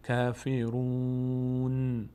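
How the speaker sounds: کافرون stretched, with the n cut off silent; stop on the n. Shown as a demonstration. Teacher showing the stop at the verse end. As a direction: hold کافرون and stop dead on the n. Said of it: "نون هم حرکت نمیدیم".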